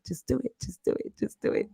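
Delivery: softly whispered just do it; whispered Nike slogan. Shown as a demonstration. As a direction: breathe the slogan out. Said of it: "Oh, Nike".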